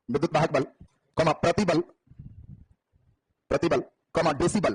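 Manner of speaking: unhurried classroom dictation. Acted. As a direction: read it out word by word for the class to write down. Teacher dictates notes slowly.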